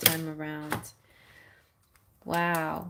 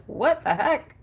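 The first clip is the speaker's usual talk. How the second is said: higher, louder startled cry; mock surprise; 7 semitones higher, 5 dB louder than usual. The spirit startled to find her, mock surprise. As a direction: act startled. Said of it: "You'd be like".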